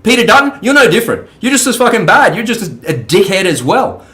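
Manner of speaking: high-pitched furious telling-off; angry rant. Real, not acted. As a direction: tear into the politician, voice climbing. Ranting at a politician.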